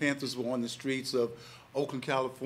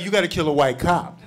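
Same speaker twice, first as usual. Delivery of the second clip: loud, amused punchline; loud laughing dare; 3 semitones higher, 11 dB louder than usual. Retold or imitated. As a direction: hit the twist hard. Friend topping the dare.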